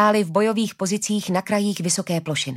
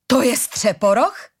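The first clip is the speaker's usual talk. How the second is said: slow, raised, disbelieving question; incredulous question; 4 semitones higher, 4 dB louder, slower than usual. A narrator's reading. Tall woman at the bridge sees Střeporoch, incredulous. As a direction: ask it slowly, unable to believe it. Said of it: "zeptala se nevěřícně".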